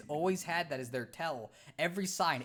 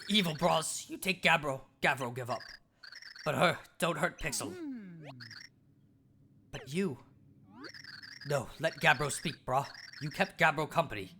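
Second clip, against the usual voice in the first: caveman Cragnon voice; 3 semitones lower than usual. Acted. Scared Cragnon hiding from the Floro Sapiens, blunt caveman voice. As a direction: bark it out like a dim, eager caveman.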